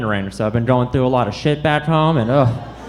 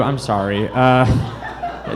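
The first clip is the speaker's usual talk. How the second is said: ordinary talk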